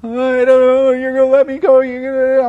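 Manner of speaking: high, loud imitation of a child wailing; mock crying. Imitating daughter afraid on the bike, mock crying. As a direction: wail it like a panicking little kid. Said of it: "she would start crying".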